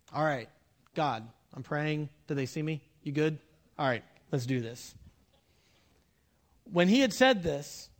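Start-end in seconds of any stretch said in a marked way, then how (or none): 0.1-4.9 low, hushed undertone; mock prayer aside. Jesus checks in with God before the miracle, in a low playful aside. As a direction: confide softly, as if checking on onlookers.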